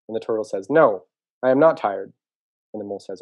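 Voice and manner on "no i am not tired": slow, loud, emphatic refusal; firm refusal. Acted. Turtle turning down the mole's nap, firm refusal. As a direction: push back firmly, drawing out each word.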